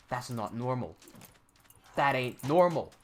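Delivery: ordinary delivery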